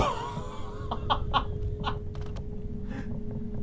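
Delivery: breathless, high nervous laughter. Real, not acted. Player laughing nervously after floating folders scare him. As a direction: laugh off the fright.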